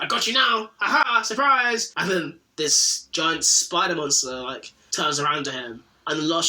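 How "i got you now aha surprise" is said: hammed-up gloating. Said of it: "the Punisher kind of goes".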